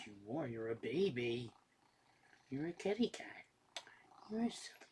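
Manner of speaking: soft, cooing pet talk. Real, not acted. Owner teasing his sleepy cat Dexter, soft cooing baby talk. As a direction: coo fondly at the sleepy cat.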